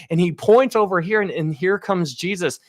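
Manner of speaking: ordinary talk, nothing marked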